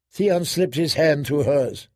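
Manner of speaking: ordinary delivery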